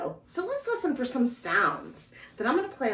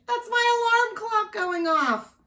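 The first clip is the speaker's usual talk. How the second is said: mock alarm; 5 dB louder than usual. Excited about loud alarm.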